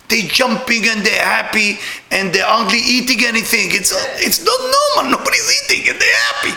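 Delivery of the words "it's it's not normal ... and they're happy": high, fast shout of disbelief; incredulous outburst. Real, not acted. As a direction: cry out in amazement. Amazed outburst about dancers.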